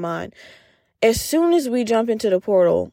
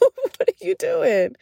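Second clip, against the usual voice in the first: high, slow, laughing question; incredulous, pitched up through laughter; laughing disbelief; 8 semitones higher, slower than usual. Real, not acted. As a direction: ask it while cracking up. Laughs in mock disbelief at self.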